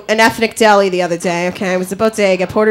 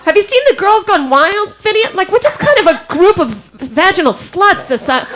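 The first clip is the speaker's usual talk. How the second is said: ordinary talk, nothing marked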